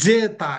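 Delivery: জেতা said slowly with a hard English j; wrong hard j. Shown as a demonstration. Teacher showing the wrong way to say জেতা, slow. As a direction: stretch জেতা with a full j sound. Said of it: "এরকমও হবে না".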